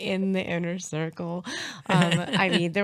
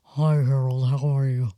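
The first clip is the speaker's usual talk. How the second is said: put-on low, loud older-man voice; Harold; 5 semitones lower, 5 dB louder than usual. Host plays her dad greeting the other dad, low loud dad voice. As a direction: drop deep and greet heartily. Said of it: "I should do Harold's voice".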